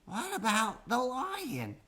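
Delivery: high, slow little voice; a small joey suggesting; baby voice. A narrator's reading. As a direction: ask timidly like a small child. Baby kangaroo asking.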